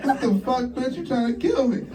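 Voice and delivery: high, outraged disbelief; high outraged squeal; high outrage. Retold or imitated. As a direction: squeal it at her. Outraged at girlfriend.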